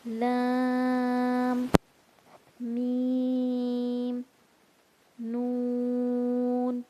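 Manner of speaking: میم and نون hummed long through the nose; nasal letter drill; slow nasal letters. Shown as a demonstration. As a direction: stretch the letter names slowly. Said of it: "جو ناک میں پڑے جاتے ہیں".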